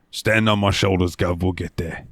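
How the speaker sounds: rough Cockney dwarf voice; Yangus. Acted. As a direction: play a sturdy Cockney sidekick. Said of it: "that Cockney accent with that rough voice".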